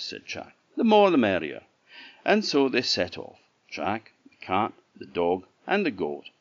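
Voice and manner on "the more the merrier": cheerful. Acted. Chak answers cheerfully.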